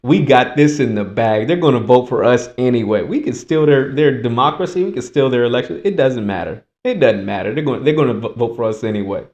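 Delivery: low, soft, lazy insider voice; hint of smug elites sitting back; smug powers that be. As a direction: murmur it like a confident elite. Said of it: "They're just".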